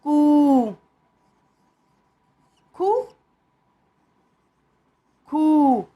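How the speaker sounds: कु and खु said loud and clear; short syllables. Shown as a demonstration. Teacher calling out syllables to the class. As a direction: call out each syllable clearly. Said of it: "ये खड़ी पाई वाले में भी लिखते हैं".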